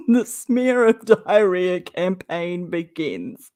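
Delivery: slow, laughing through the words. Real, not acted. Mocking Katie's smear campaign, laughing slowly. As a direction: giggle out the phrase.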